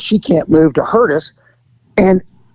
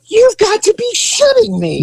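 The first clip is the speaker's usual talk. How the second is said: high, put-on voice of disbelief; high mock disbelief; incredulous. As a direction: sound completely incredulous. Own disbelief retold.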